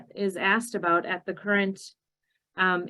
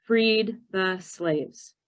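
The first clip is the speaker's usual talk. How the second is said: slow deliberate answer; much slower than usual. Shown as a demonstration. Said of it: "I just teach".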